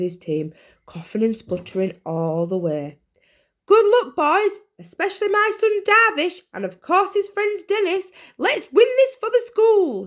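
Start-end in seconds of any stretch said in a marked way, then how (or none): 3.6-10.1 high, loud touchline cheer; cheering shout. A narrator's reading. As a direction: shout from the sidelines. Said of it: "shouted Darvish's mum".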